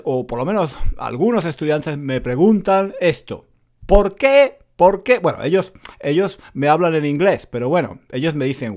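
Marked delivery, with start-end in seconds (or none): none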